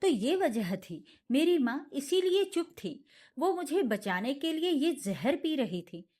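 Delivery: ordinary delivery, nothing marked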